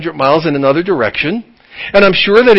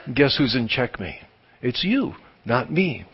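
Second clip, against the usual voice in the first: softer, much lower, smug taunt; quiet smug taunt; 6 semitones lower, 11 dB quieter than usual. Retold or imitated. God quietly gloats over the checkmate. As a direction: hush it and gloat.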